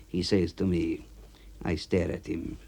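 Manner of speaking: ordinary delivery, nothing marked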